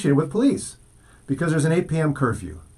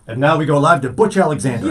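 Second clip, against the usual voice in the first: louder, booming live-TV handoff; hammed-up anchor calling out Butch's name; mock news anchor; 4 dB louder than usual. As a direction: announce it like a big broadcast anchor.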